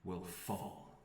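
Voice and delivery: solemn, slowing scene-setting; game master narration. A narrator's reading. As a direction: let the ending land softly. GM describes the finale.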